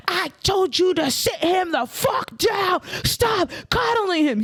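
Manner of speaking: high, slow nagging yell; mock bossy wife. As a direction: imitate the wife barking at her husband. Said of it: "And she's like".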